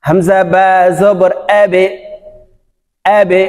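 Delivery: measured spelling, then "আবে" with an echoed ending; downward qalqala. Shown as a demonstration. Teacher showing learners "আবে", downward qalqala. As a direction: bounce the ব of "আবে" downward to a short e. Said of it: "নিচের দিকে ধাবিত হবে".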